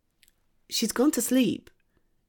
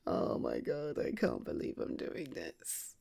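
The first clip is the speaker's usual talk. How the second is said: low, hushed disbelief; much softer, lower aside; embarrassed under breath; 10 semitones lower, 11 dB quieter than usual. Acted. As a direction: mutter it to yourself. Disbelief at adopting cat.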